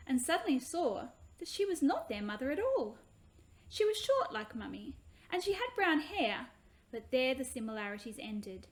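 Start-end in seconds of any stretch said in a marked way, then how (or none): none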